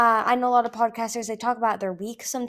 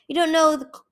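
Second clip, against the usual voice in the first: higher, louder contempt; scornful; 8 semitones higher, 8 dB louder than usual. Acted. Icicle scorning her brother, scornful. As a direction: sneer at his weakness.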